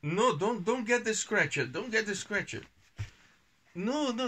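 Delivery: mock panic to the son; playful alarm. Real, not acted. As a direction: stop him before he grabs it. Dad stops child fetching the scratcher.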